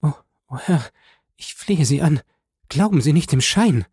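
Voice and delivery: high, pleading stammer; wife begging through her hands; stammering plea. A narrator's reading. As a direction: beg him desperately. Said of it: "stammelte die junge Frau".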